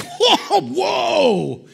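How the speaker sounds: high startled mock shout; shocked whoa. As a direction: act the jolt of being caught off guard. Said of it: "You're like".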